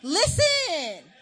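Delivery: high, loud and slow, cutting them off; yelling at the kids to listen; exasperated shout. Retold or imitated. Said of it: "I'm like".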